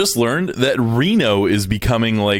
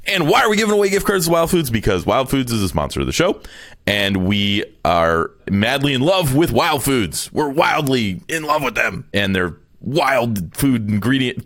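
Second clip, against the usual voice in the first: hammed-up ad read; 3 semitones higher than usual. Reading the sponsor spot to listeners, hammed-up ad voice. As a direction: sell it like a cheesy commercial. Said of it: "God, this is a terrible ad".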